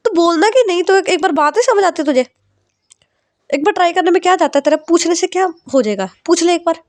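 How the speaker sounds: mother scolding her daughter on the phone, high and sharp. Acted. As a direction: nag her hard, pitch way up. Mother scolding daughter over leave.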